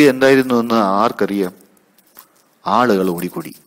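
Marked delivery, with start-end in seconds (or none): none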